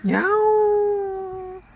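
high, drawn-out meow; cat meow. Pretend. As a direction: stretch the meow like a kitten. Dad meows like cat.

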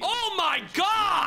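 drawn-out excited shout, high. Real, not acted. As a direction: stretch it out, yelling at the big hit. Big hit, streamer shouts.

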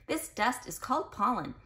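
ordinary delivery

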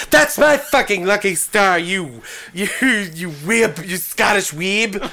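hammed-up sputtering indignation; mock outrage. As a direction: scold him, fumbling for the insult. Maiden scolds Bond over her anime.

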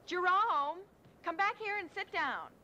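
calling across the room to Jerome. Real, not acted. Calling a child back.

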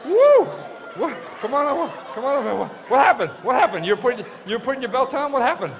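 high, loud worried questions; high concerned calling. Real, not acted. To the volunteer after the snake bite, high and worried. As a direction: rush to check he's okay.

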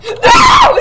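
high loud no; high shocked shout; startled yell. Real, not acted.

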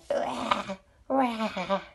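small, low playful growly rahs; tiger roar. Pretend.

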